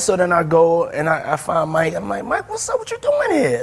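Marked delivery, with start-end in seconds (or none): 2.2-3.6 high, delighted hello; thrilled host, voice pitched high; excited greeting. Retold or imitated. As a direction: greet a surprise guest with glee. [name] greets [name] at party.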